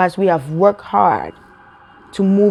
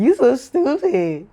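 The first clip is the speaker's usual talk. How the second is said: high, drawn-out teasing jab; animated mock insult, stretched out; excited insult; 5 semitones higher, slower than usual. Shown as a demonstration. Someone calling God stupid, excited and mocking.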